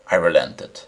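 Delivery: plain reading voice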